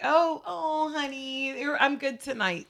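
high, sugary mock-sweet reply; sweet wife voice. Host mimicking his sweet wife. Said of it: "she's gonna be like".

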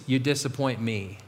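low, soft reproach; hushed, sinking disappointment; disappointed inner voice. Acted. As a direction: deliver the blame low and quiet. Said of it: "you hear this voice".

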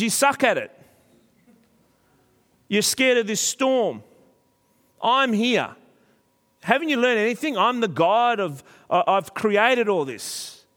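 high, slowed, scolding outburst; Jesus in the boat scolding his frightened disciples; stern rebuke. Retold or imitated. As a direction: berate them for their fear. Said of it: "Literally, the language is very strong".

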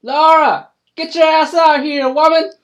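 loud, high yell, hollering; hillbilly scream. Acted. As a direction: holler at your wife to come out. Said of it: "Cletus Ray screaming".

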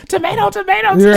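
high, loud joking shout; very high pretend jeer; mock heckling. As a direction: call it out like a playful heckler. Mimicking crowd throwing tomatoes.

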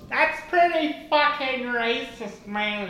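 a lightly voiced high inner conscience. The voice in his head judging his accent, high mock scolding. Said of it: "that voice in your head that goes".

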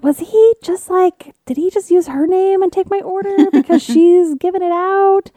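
mock shocked disbelief; incredulous. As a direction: sound baffled and scandalised. Retold incredulous thought.

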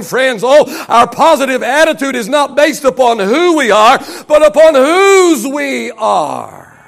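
fervent sermon cadence with long-held key words; full-force preaching. Real, not acted. Preacher telling friends where the power comes from, full-force preaching.

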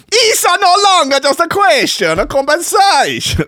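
very high, loud cartoon Italian accent; grotesque parody Italian. Podcaster redoing the quote as grotesque parody Italian. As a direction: go over the top with a shouted Italian caricature. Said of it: "the grotesque sort of parody Italian".

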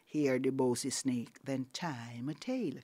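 plain reading voice

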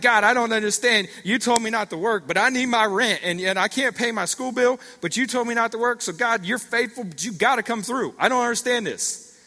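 retold frustrated yell, high and loud; acting out a worked-up, indignant prayer; yelling at God. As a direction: plead your case loudly to God. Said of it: "But he'd be yelling".

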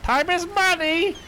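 high, slow squawking parrot cry; shrill bird yell of time is money. Acted. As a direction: screech it like a parrot. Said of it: "Parrot yells out".

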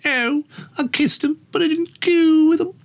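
high-pitched mock Scouse; hammed-up Liverpudlian, way up high; Liverpool accent. Shown as a demonstration. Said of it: "that's my Liverpool accent".

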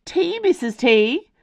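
high, loud, excitable little voice; chorusing teacups. A narrator's reading. Teacups chorusing to the teapot, eager. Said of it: "chorused the teacups".

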